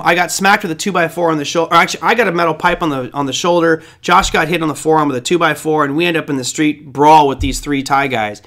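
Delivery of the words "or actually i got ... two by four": normal talking voice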